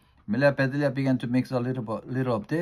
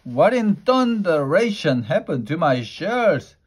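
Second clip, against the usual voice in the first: loud, higher angry roar of a husband; mock roar; 6 semitones higher, 6 dB louder than usual. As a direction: bellow it out like an outraged husband. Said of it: "Mister Rogers roared".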